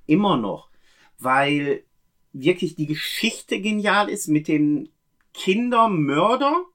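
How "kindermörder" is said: "Kindermörder" drawn out slowly, rising high; slow, heavily stressed "Kindermörder"; emphasis on Kindermörder. Real, not acted. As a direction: stretch "Kindermörder" and lift the pitch for weight. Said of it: "Ich betone direkt Kindermörder".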